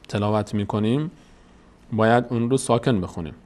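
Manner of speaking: usual speaking voice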